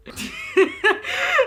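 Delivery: loud shrieking cackle; high burst of laughter. Real, not acted. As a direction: break into helpless laughter. Laughing reading absurd comment.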